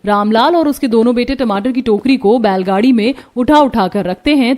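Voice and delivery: ordinary delivery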